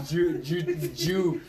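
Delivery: stuck, stammering on one syllable; Bill Burr. Retold or imitated. Retelling a comedian's confusion on his podcast, stammered imitation. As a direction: stumble repeatedly over the first sound. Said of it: "Bill Burr where he didn't understand".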